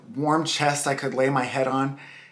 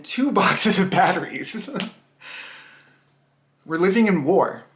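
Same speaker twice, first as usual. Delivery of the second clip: amused laughing; 4 semitones higher than usual. Acted. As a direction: chuckle breaking into the words. Amused by dad's absurd shopping list.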